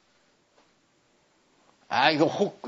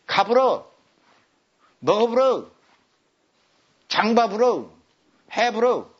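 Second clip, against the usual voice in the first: Jeolla dialect forms; 3 semitones higher than usual. Shown as a demonstration. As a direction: show off the Jeolla 불어 ending. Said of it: "전라도 말로".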